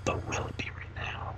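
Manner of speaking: hushed whisper; whispered aside. Real, not acted. Streamer whispering a question during a game cutscene. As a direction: whisper it to yourself.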